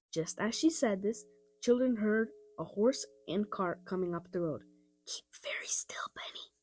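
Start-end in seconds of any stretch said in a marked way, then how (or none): 5.0-6.5 soft urgent whisper. A narrator's reading. Henry hushing Benny as the cart passes, whispering. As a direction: breathe it so nobody on the road hears. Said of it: "whispered Henry".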